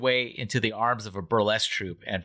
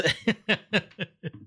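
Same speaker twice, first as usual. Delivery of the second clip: high laugh; 10 semitones higher than usual. Real, not acted. Laughing at joke.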